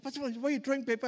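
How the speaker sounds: a puzzled member asking fast; quick high questioner. Retold or imitated. Churchgoer asking the pastor about paper, quick high question.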